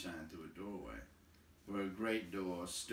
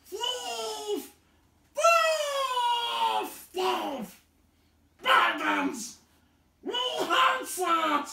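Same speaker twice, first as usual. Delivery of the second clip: far higher, screaming creature fury; shrieking Gollum voice; 17 dB louder, much slower than usual. A narrator's reading. Gollum screaming after the fleeing thief, shrieking hatred. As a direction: shriek it like a hateful cave creature. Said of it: "but menacing, the voice came behind".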